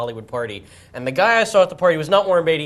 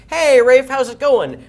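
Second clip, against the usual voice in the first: high, loud pretend-pal hello; chummy greeting; 9 semitones higher, 8 dB louder, slower than usual. Man greets the star in front of his girl, chummy. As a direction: hail a famous friend for show. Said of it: "come over and say".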